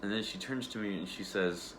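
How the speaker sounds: ordinary talk, nothing marked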